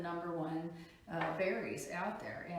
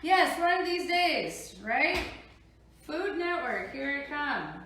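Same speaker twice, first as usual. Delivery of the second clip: excited; 7 semitones higher, 8 dB louder, slower than usual. Real, not acted. Jokes about a Food Network show.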